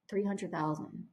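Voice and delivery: matter-of-fact, unbothered; no emotion. Retold or imitated. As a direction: say the number totally flat. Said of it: "no emotion".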